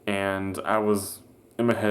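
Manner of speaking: ordinary talk, nothing marked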